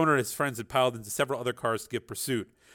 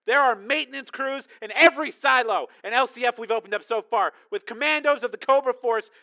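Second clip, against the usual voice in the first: much higher, pressing tense voice; urgent caller; 13 semitones higher, 5 dB louder than usual. A narrator's reading. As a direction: cut her off urgently. Tracy voiced on phone.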